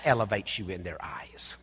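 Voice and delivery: sudden whisper after preaching; whispered aside. Real, not acted. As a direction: lean in and whisper it. Sudden drop to intimate aside.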